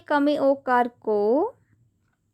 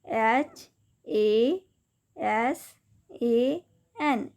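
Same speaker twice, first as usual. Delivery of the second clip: slow spelling; 2 semitones lower, 3 dB quieter, much slower than usual. Shown as a demonstration. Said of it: "हसन".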